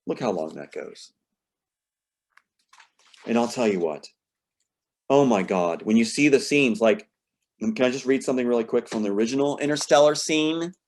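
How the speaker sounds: ordinary talk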